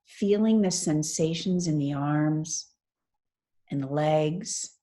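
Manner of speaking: measured meditation-teacher delivery with long pauses; guided meditation. Real, not acted. Teacher guiding a class lying on the floor through mindful movement, guided meditation. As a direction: lead them through it phrase by phrase, unhurried.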